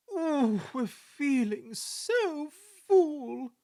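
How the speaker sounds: overloaded coaches moaning; grumbling. Acted. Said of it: "grumbled the coaches".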